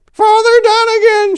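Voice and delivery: put-on very high, loud old woman's shriek; shrill old woman. A narrator's reading. Old woman calling the priest, shrill and loud. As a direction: shriek his name down the phone. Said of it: "she hollered".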